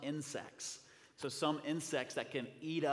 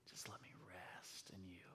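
breathy hushed whisper; whispered plea; 6 semitones lower, 15 dB quieter than usual. Acted. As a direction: breathe it to God, barely voiced. Whispered plea within prayer.

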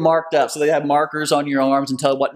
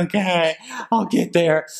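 higher, strained mock-reluctant voice; mock weary reply; 5 semitones higher than usual. Racer to his encouraging partner, mock weary. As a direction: give in as a struggling racer.

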